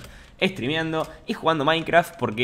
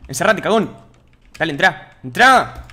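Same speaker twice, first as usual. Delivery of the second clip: louder, higher yelling at a villager; shouting orders; 6 semitones higher, 12 dB louder, slower than usual. Real, not acted. Streamer yells at a villager to get inside.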